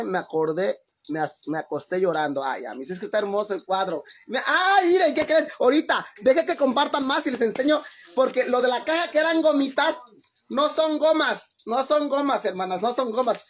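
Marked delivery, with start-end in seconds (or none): 4.3-13.4 excited reveal. Real, not acted.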